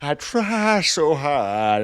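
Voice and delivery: drawn-out cartoon dad voice; repeating the line as Peter Griffin, slow and loud. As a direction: draw each word out like Peter Griffin. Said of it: "why did I say that like Peter Griffin?".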